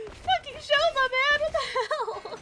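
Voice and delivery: very high, half-laughing wail; exasperated squeal. Real, not acted. Streamer exasperated with Shoma, high-pitched wail. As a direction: squeal it out in helpless disbelief. Exasperated outburst at game character.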